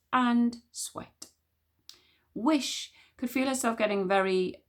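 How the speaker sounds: plain reading voice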